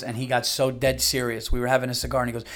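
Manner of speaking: ordinary talk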